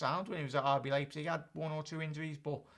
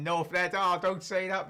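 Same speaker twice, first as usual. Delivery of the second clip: mock outrage; 5 semitones higher, 8 dB louder than usual. Real, not acted. Mock outrage at chat comment.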